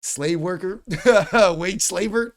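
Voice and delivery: high, loud, laughing taunt; joking insult broken by real laughter; laughing jab. Real, not acted. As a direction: crack up while teasing.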